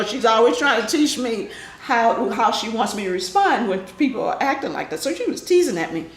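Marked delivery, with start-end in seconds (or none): none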